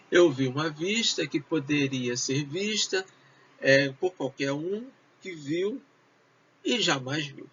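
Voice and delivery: usual speaking voice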